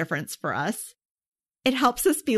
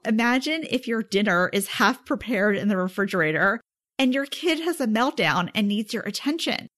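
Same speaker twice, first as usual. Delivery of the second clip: normal talking voice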